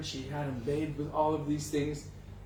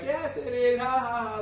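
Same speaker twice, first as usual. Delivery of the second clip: high teasing ha ha ha; mocking laugh; 7 semitones higher than usual. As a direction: taunt him with a sing-song laugh. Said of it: "they're laughing like".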